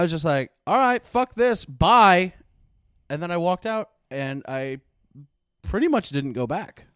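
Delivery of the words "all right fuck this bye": loud, raised retold kiss-off; fed-up dismissal. Teen quitting on the door guard, retold fed-up exit. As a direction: throw it out loudly and be done.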